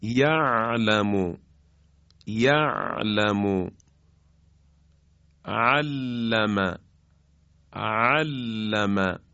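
ع in یعلم and علم soft and stretched, slow; slow, smooth, lengthened ع; soft drawn ain. Shown as a demonstration. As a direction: draw out each ع gently. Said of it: "خیلی نرم و با کشش ادا بشه".

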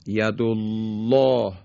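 ید الله with a thick, full L; heavy lam. Shown as a demonstration. Teacher giving an example after a damma, ید الله with heavy lam. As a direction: recite ید الله slowly, making the lam heavy. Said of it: "این درشتی و تقلیظ حرف لام".

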